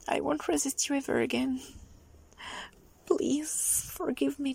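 ordinary delivery

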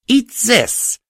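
high, loud attack shout; high-pitched, forceful taunt to a monster; battle cry. Acted. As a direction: yell it as you open fire. Heroine attacks snarling monster.

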